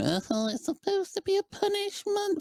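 high, whiny mock of critics. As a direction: sneer it like a know-it-all. Mocking critics' objection.

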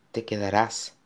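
soft, breaking plea; almost unable to speak; barely audible whisper. A narrator's reading. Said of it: "le susurró Connor ... casi incapaz de hablar". (susurro débil, casi sin voz)

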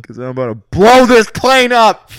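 loud, slow, high menacing announcement; loud threat over intercom. Pretend. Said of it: "intercom and be like".

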